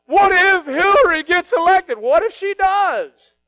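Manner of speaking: high, loud, slow hammed-up fretting; mock a cable-news worrier; over-the-top anxious voter; mock panicked viewer. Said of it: "Everybody's freaking out".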